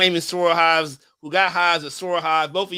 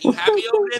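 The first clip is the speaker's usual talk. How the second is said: high mock voice; 4 dB louder, much faster than usual.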